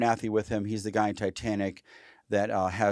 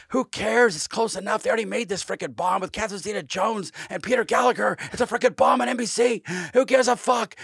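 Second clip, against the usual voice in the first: defiant rant; 11 semitones higher, 3 dB louder than usual. Retold or imitated. Cameron defiant against studio.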